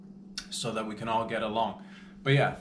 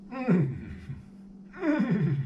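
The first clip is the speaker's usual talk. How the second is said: savouring hum; 10 semitones higher than usual. Acted. Savouring the blood.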